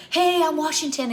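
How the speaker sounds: high, loud playful announcement; daughter's voice. Retold or imitated. Daughter announcing her team at the stairs, high and playful. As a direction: chirp it like a proud kid.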